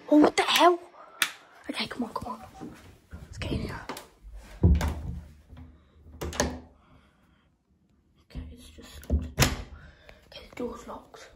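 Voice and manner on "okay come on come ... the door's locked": urgent hushed whisper. Real, not acted. Player sneaking to the bathroom, urgent whisper. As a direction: keep your voice down, hurry.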